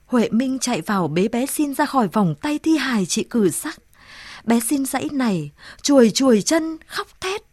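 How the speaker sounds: ordinary delivery, nothing marked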